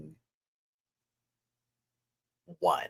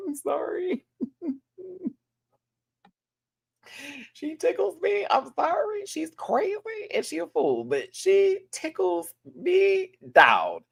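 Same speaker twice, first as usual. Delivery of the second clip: much higher, giggly apologies; laughing; slower than usual. Real, not acted. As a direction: laugh through the words. Laughing at Alyssa.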